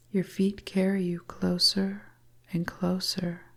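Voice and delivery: usual speaking voice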